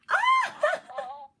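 sudden high, loud cry of surprise; short shouted ah; surprised shriek. Real, not acted. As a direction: yelp out in shock. Surprised by toy's noise.

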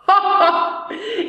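hearty jeering laugh. Acted. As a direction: burst out laughing at the ghost. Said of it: "Er lachte so heftig".

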